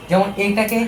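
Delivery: ordinary talk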